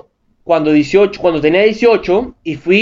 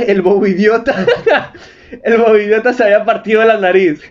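laughing through words; 2 semitones higher than usual. Real, not acted. Reading story, laughing.

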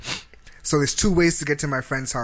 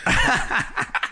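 sudden high breathy laugh at the memory; burst of laughter; 11 semitones higher than usual. Real, not acted. Telling friends the cop was the same guy, laughing. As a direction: crack up laughing mid-story. Laughing at his own story.

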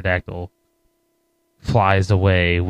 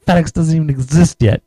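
higher-pitched mock indignation; retold angry complaint; mad caveman; 7 semitones higher than usual. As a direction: imitate an outraged protest. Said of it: "he gets so mad".

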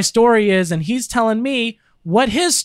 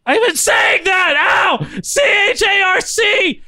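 frustrated shout; 10 semitones higher, slower than usual. Real, not acted. Frustrated under cheek pinching.

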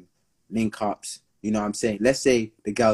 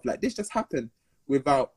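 ordinary talk, nothing marked

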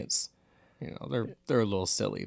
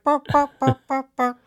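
imitating dog barks; 9 dB louder than usual. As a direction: bark the words like a yappy dog, very high and loud. Imitating the Goosebumps dog barking.

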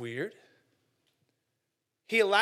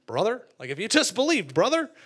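mock churchy lecture, louder and rising toward brother; 4 semitones higher, 8 dB louder than usual. Imitating Christians lecturing a struggling believer, loud mock preachiness. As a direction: lay on the brother thick. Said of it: "why do Christians call each other that".

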